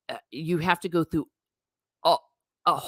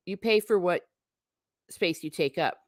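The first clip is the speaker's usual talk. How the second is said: usual speaking voice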